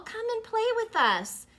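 high, loud playful call. Acted. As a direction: call out to a friend. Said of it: "they would call".

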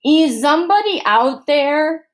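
loud, slow suspicious call into the dark; shouting. Acted. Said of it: "he shouted".

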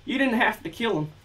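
high, loud southern plea; trembling shout. Acted. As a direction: cry out at the killer, voice trembling. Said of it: "his voice trembling".

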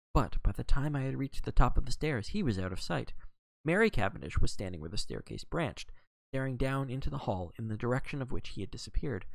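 usual speaking voice

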